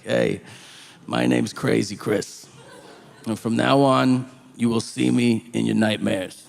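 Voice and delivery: a lightly voiced scary inmate; mock menacing convict.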